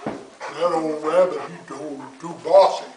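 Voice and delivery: a hint of a slow bear voice; Brer Bear. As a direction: grumble it like a lazy bear. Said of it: "says Brer Bear".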